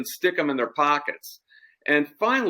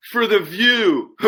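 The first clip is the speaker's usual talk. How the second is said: slow, louder smarmy line with a laugh; leering; 6 semitones higher, 5 dB louder, slower than usual. Retold or imitated. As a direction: savor the punchline. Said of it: "He goes".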